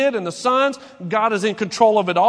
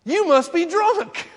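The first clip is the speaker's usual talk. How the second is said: high, slow, laughing scoff at a believer; mocking disbelief; 9 semitones higher, 4 dB louder, slower than usual. Skeptic scoffing at a believer, high laughing disbelief. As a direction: laugh it off as if they are crazy. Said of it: "what their response would be".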